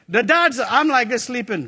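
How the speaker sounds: ordinary talk, nothing marked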